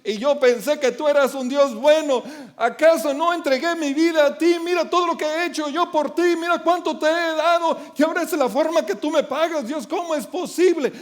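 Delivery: mock complaint to God, half-hearted; queja a Dios. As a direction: whine at God as if betrayed. (queja fingida a Dios)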